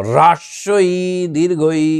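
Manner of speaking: হ্রস্বই said crisply with the r cluster, দীর্ঘই said separately and clearly; careful pronunciation. Shown as a demonstration. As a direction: spell out হ্রস্বই দীর্ঘই precisely for pupils. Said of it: "একটু ভালো করে উচ্চারণ করলে". (স্পষ্ট উচ্চারণে হ্রস্বই দীর্ঘই)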